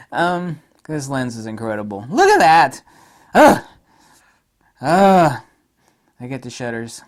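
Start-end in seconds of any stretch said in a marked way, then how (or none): 2.1-5.4 loud, high gasps of delight; delighted groans. Real, not acted. Reviewer groaning with pleasure at photos. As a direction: groan out loud at how good it is. Swooning over images again.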